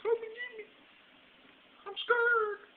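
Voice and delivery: put-on very high, thin childlike plea; praying to God in a falsetto, hammed-up fear; mock scared squeak. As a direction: squeak it like a frightened kid. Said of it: "Called on God".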